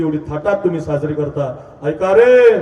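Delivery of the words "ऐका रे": high, loud drawn call for attention. Real, not acted. Preacher telling the youths to listen, calling out. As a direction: holler to the whole crowd.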